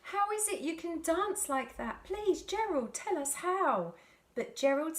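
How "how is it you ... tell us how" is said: high, eager begging; eager pleading. A narrator's reading. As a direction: beg him to share the secret. Animals begging Gerald.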